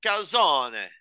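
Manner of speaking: mock Italian pronunciation of Calzone; Italian accent. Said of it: "I am allowed to do an Italian accent".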